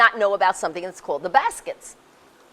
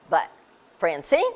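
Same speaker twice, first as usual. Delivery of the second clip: usual speaking voice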